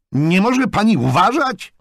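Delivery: loud, high-pitched indignant yell; angry shout. A narrator's reading. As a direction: shout the reproach at a stranger. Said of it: "krzyknął".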